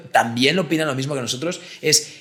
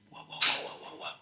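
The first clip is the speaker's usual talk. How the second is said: awed whisper; 12 dB quieter than usual. Retold or imitated. Imitating child's awe.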